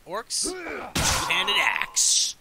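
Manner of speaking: long, drawn-out high shout; slow, loud roar; battle shout. Acted. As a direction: bellow it like an orc's solution. Orc battle boast.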